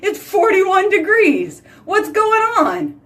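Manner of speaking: high, slow mock outrage at the cold; hammed-up high wail of disbelief; exasperated shock. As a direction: act out waking up to a freezing morning. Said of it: "I get up and I'm like".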